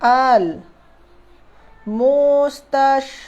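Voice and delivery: slow, isolated words with long gaps; word-by-word dictation. Shown as a demonstration. Teacher writing Haji's sentence, slow piecemeal dictation. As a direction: say each piece as you write it.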